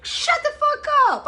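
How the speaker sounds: a sky-high exasperated shriek; outburst. Retold or imitated. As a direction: shriek it at him, fed up. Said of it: "I'm like".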